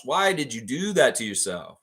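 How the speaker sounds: ordinary talk, nothing marked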